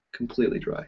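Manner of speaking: whispered sarcastic echo. Retold or imitated. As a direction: breathe it as a private aside. Whispered echo of same words.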